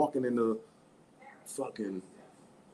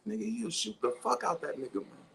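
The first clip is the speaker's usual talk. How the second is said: usual speaking voice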